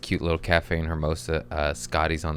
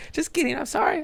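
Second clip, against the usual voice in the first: giggly mock apology; faster than usual. Takes back a racist-sounding joke.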